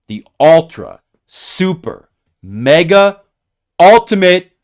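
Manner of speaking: hammed-up hype for viewers; hype build-up. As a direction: pump up every adjective like an announcer. Hyping a big secret reveal.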